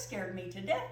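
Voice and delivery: plain reading voice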